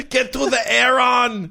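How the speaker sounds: high, slow mock action-hero bark; hammed-up Austrian action star yell; Arnold Schwarzenegger. Riffing on the Predator joke, mock Arnie yell. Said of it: "full on Arnie from Predator".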